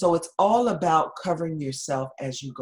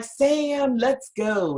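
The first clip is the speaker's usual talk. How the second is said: high, loud call across the ER; 9 semitones higher, 5 dB louder, much slower than usual. Retold or imitated. Nurse calling her cousin to lunch, loud and high. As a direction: call out to your cousin so everyone hears. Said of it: "And you're so loud".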